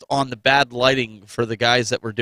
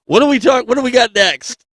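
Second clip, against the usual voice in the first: loud excited tease; 9 semitones higher, 11 dB louder than usual. Real, not acted. Laughing, loud teasing after joke.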